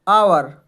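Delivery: आवर with no h sound, starting on a; silent h in hour. Shown as a demonstration. Teacher showing students आवर with silent h. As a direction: say आवर, not हावर. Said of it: "हावर नहीं पढ़ना है इसको".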